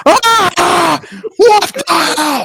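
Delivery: high panicked yelp; startled scream. Acted. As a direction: shriek as a shark lands on deck. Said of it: "you hear screams of people going".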